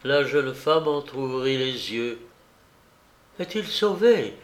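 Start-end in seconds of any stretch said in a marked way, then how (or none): 3.4-4.3 higher-pitched, urgent question without shouting; urgent cry. A narrator's reading. Rescued woman asks after the fugitive, crying out. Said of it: "s'écria-t-elle".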